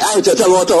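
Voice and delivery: nada jauh lebih tinggi, menantang; tantangan main-main. Acted. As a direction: ajak bercanda dengan nada tinggi. Voiced challenge in the story. (Umar menantang Munkar Nakir dengan nada tinggi)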